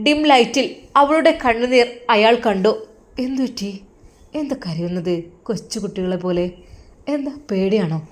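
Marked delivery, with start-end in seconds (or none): 3.2-8.0 low, hushed husband teasing his wife; lightly voiced husband, soft and low; husband's voice. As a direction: lower your voice and coax her gently.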